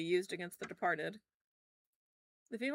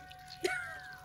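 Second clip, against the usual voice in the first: high shrieking laugh; 8 dB quieter than usual. Real, not acted. Laughs at the idea.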